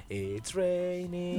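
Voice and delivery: very slow teacher demo; TPR demo. Shown as a demonstration. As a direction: draw it out for the kids. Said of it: "entonces nosotros decimos".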